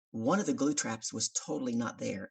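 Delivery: ordinary talk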